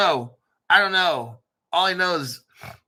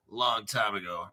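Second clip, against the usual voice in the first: normal talking voice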